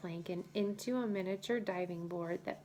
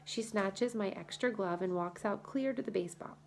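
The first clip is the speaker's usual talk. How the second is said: ordinary delivery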